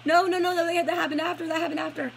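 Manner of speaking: high, loud no, no, no, catching her own mistake; flustered self-correction. Real, not acted. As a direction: stop yourself mid-sentence, flustered. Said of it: "correcting herself mid-story".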